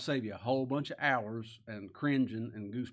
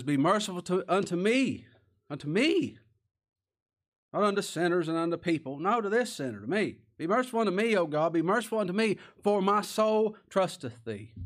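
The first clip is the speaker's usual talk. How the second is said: impassioned psalm plea; 6 semitones higher, 6 dB louder than usual. Acted. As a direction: plead for mercy at full voice. Preacher voicing David's plea.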